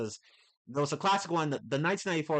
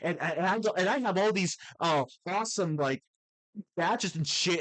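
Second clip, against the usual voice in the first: put-on higher, louder, chirpy kid-show host voice; overly chipper patronizing cartoon teen; mocking Peter Parker voice; 5 semitones higher, 4 dB louder than usual. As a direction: talk down to kids like a cheesy cartoon hero. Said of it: "the voice that Peter Parker uses".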